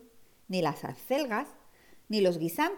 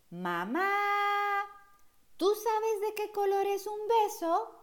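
put-on high child voice; 12 semitones higher than usual. Child asking mother.

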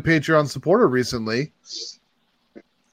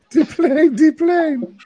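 put-on high-pitched accented character call; comic catchphrase voice; 13 semitones higher, much slower than usual. Joker announcing the plane, in a high character voice.

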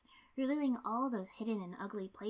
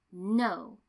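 a much louder, firm refusal; 9 dB louder than usual. A narrator's reading. Tenten points at Shikako's forehead and refuses, firmly and loudly. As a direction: cut her off with one hard word. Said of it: "she said firmly".

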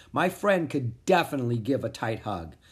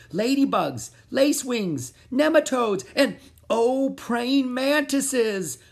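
rising high, loud excited list; cheer, building to the last; 11 semitones higher, 5 dB louder, slower than usual. Acted. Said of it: "I cheered".

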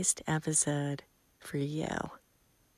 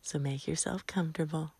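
soft, hushed bedtime voice with long pauses; sleep meditation; 5 dB quieter than usual. Real, not acted. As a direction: lull the listener gently, phrase by phrase. Guides listeners toward sleep.

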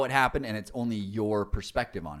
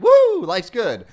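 loud, high, drawn-out woo; mock party cheer; carefree whoop; 12 semitones higher, 14 dB louder, much slower than usual. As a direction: whoop it up like life is easy. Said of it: "you're just like".

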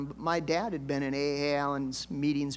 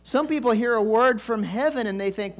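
raised, loud preaching push; preaching at full force; 6 semitones higher, 7 dB louder than usual. Acted. Preacher exhorting his congregation, higher and louder. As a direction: drive the point home to the congregation.

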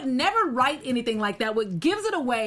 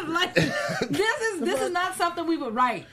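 high, laughing through the words; 6 semitones higher, slower than usual. Real, not acted. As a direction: laugh while making the point.

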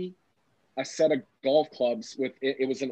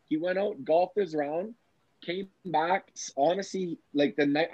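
usual speaking voice

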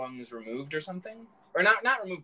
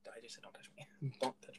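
hushed annoyed; 3 semitones lower, 17 dB quieter than usual. Real, not acted.

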